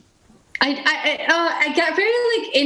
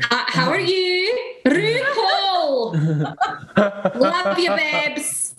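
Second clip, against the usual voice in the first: high, playful Scottish impression; Lawrence Chaney's Scottish accent; 6 semitones higher, much slower than usual. Shown as a demonstration. Contestant amusing the others as Lawrence, high Scottish accent. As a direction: lift it high with a put-on Scots lilt. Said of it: "Lawrence Chaney is from Scotland".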